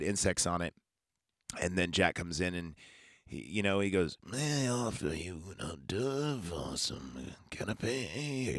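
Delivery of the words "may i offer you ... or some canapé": slow, soft, oily madman voice; lightly voiced asylum inmate, slow and hushed; creepy Renfield offer.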